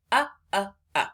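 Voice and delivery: "uh, uh, uh" clipped and punched; short U sound. Shown as a demonstration. Teacher signs the letter U and says "uh". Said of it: "sign it and say".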